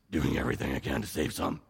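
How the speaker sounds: hammed-up Batman impression from the pulpit. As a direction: drop the voice low like a brooding caped hero. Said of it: "in the Batman voice".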